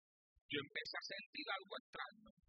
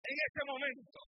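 ordinary delivery

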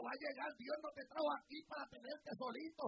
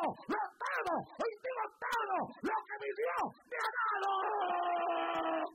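preacher building the crowd to a peak; preaching at full force; 4 semitones higher, 12 dB louder than usual. Acted. Preacher exhorting the congregation, loud and rising.

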